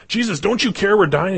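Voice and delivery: high, whiny appeal; mock-panicked plea. Disciples to Jesus in the storm, mock-panicked plea.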